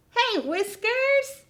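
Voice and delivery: high bright hello; delighted greeting. Acted. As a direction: cheer as your friend appears. Greets the arriving puppet.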